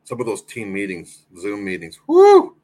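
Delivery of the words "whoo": high, loud "whoo!"; sudden high-pitched whoop; excited whoo. Real, not acted. Reacting to remembered British women.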